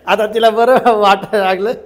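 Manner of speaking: loud words through laughter; 1 laughing while talking. Real, not acted. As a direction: speak while still laughing.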